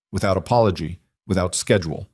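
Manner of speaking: ordinary delivery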